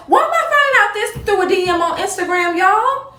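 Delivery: outraged disbelief. Real, not acted. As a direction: sound fed up and incredulous. Outraged at learning by DM.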